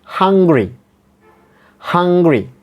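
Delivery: "hungry" said high and clear, first syllable stressed; model pronunciation. Shown as a demonstration. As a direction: stress HUN in "hungry" for learners. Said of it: "see on the screen".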